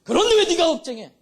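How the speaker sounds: high-pitched forceful divine shout. Retold or imitated. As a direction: thunder the question as God. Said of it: "더 큰 소리".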